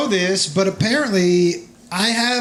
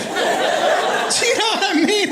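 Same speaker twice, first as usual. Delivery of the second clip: usual speaking voice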